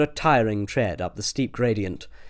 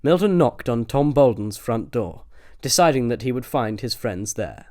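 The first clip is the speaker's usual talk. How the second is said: ordinary delivery, nothing marked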